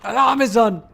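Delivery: loud, high-pitched alarm call; shouted alarm. Pretend. Gamer calling out the alarm mid-game, high shout. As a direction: shout the warning in a put-on voice.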